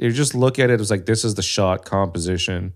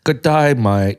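louder mock-Aussie greeting; punchy Australian impression; Australian accent; 4 dB louder, slower than usual. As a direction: echo it in a put-on Australian accent. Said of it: "try to do an Australian accent".

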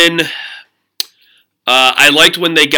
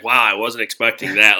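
quiet, flat monotone imitation; deadpan, no excitement at all; 5 semitones lower, 4 dB quieter than usual. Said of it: "She's like".

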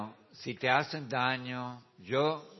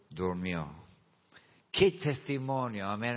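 normal talking voice

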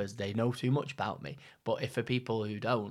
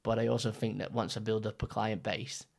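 ordinary talk

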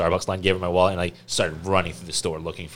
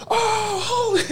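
very high amazed cry; excited; 4 dB louder, slower than usual. Retold or imitated. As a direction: gasp it out in disbelief. Crowd reacting to big block.

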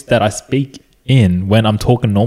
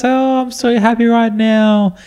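high, slow, sugary cheerful voice; mock sing-song sweetness, as if nothing is wrong; overly sweet and calm; 11 semitones higher, slower than usual. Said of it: "Like this".

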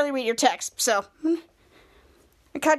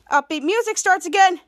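plain reading voice

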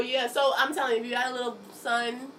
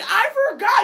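much higher, louder whiny excuse; imitating the son; 12 dB louder than usual. Son excuses himself to his mom, imitated much higher. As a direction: play the kid making a lame excuse.

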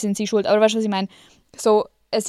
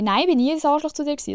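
quick, higher defensive parent voice; mock defensive parent; 6 semitones higher, faster than usual. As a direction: rattle it off like a denying parent. Mocking parents' defensive reply. (schnell und höher, abwehrende Eltern nachgeahmt)

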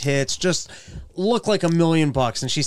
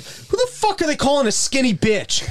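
high-pitched mock outrage; retold indignation; 7 semitones higher than usual. Man retelling his reaction to the bill, indignant. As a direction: replay being offended, pitched up. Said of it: "I actually got mad".

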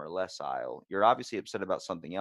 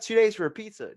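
high, loud incredulous question; mock outrage at a two-day pizza; mock disbelief; 11 semitones higher, 8 dB louder than usual. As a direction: sound scandalised at the wait.

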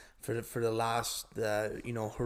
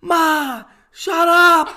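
high, very loud mock shout at mom; exasperated son yelling; 16 dB louder, much slower than usual. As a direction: holler back at your mother, imitating a fed-up son. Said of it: "Giuseppe turns around".

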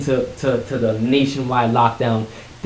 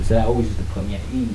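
ordinary talk, nothing marked

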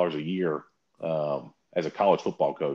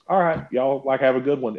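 breezy goodbye; 6 semitones higher, 5 dB louder than usual. Retold or imitated. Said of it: "and he says".

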